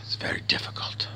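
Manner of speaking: hushed whisper, resigned; weary whispered sign-off. Real, not acted. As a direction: breathe the words out, barely voiced. Closing weary reflection.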